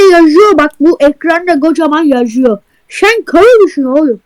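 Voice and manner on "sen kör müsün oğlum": usual speaking voice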